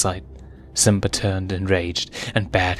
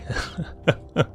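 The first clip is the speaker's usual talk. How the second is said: villain laugh; 9 semitones higher, 4 dB quieter than usual. Acted. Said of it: "The laugh sent a piercing chill".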